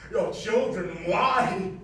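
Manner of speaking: loud, shocked accusation; horrified outcry. Acted. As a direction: shout at her betrayal.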